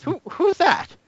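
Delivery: high, surprised squeak; startled professor. Acted. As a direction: react like a jumpy old inventor. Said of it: "he goes".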